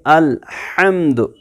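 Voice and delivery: अल्हम्दु लिल्लाहि रब्बिल आलमीन in recitation voice, आलमीन drawn out; Quran recitation. Shown as a demonstration. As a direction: recite it, holding आलमीन long. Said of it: "तो पढ़ेंगे".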